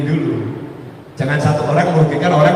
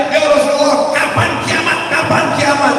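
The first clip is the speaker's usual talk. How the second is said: shouting question; 6 semitones higher than usual. Retold or imitated. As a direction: shout the question over the sermon. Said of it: "Dia teriak-teriak".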